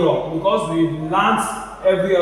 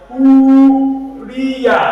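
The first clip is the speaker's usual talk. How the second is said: "Uriya" chanted higher with a long held U; stretched first vowel; 4 semitones higher, 4 dB louder than usual. Shown as a demonstration. Teacher modelling for repetition, "Uriya" with stretched U. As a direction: hold the U of "Uriya", keep "ya" short. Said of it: "this vowel is going to be stretched".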